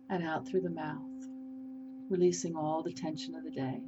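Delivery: unhurried meditation leader with long pauses; guided meditation. Acted. Host leading listeners through a healing process, guided meditation. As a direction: guide them gently through a healing, phrase by phrase.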